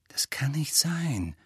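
slow, hushed disbelief; quiet contradiction. A narrator's reading. Hermine contradicting Ron's suspicion of a traitor, quietly. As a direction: whisper the objection slowly. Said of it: "widersprach Hermine leise".

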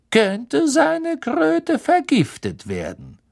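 slow, drawn-out announcement swooping high; theatrical, threatening schoolmaster, taking their time; menacing cold teacher. A narrator's reading. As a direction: lecture the class with gloating menace.

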